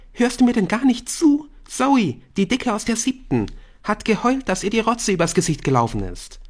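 put-on high indignant teen girl; huffy gossipy schoolgirl; Bryn voice. A narrator's reading.

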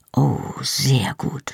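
slow, drawn-out whisper; hushed approval. A narrator's reading. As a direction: whisper it slowly, with conspiratorial approval. Secret talk over unconscious guard. (leise, gedehnt geflüstert)

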